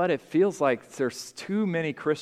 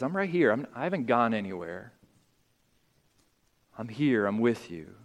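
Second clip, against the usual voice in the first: softer, lower, close and gentle; tender, quiet reassurance; 3 semitones lower, 5 dB quieter, faster than usual. Retold or imitated. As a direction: lean in and promise you never left. God answering the one who calls.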